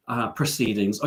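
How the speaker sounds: ordinary delivery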